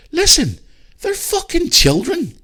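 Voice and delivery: high, heated outburst at parents; exasperated rant. Real, not acted. Podcaster ranting at parents who indulge cat kids, high and exasperated. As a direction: voice shooting up high in disbelief.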